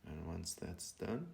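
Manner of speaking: slow, hushed meditation voice with long pauses; guided meditation. Real, not acted. Teacher guiding listeners to breathe and be present, calm meditation voice. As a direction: ease them gently into stillness, phrase by unhurried phrase.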